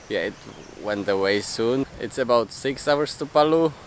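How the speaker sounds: ordinary talk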